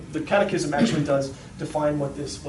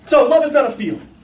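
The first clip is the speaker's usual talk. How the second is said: usual speaking voice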